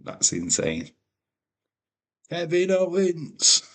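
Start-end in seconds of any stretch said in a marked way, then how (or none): 2.3-3.6 slow, higher ring announcer voice; announcer call. Pretend. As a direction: boom the name like a ring announcer. Reading chat name in announcer voice.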